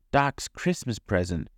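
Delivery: plain reading voice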